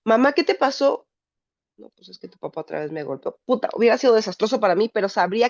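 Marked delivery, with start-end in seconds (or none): 0.1-1.1 put-on anxious child. Child asks bruised mother. 1.8-3.4 quiet honest mother. Retold or imitated. Mother's imagined honest answer.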